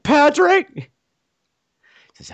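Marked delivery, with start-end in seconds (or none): 0.0-0.7 high, flustered mock squeak; high-pitched blurt. Pinned man gives up his name, high blurt.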